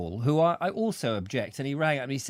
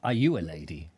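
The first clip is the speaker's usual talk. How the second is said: Are you a lady said much lower; deep teasing echo of the question; low mock echo; 10 semitones lower than usual. Shown as a demonstration. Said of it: "echo in lower voice".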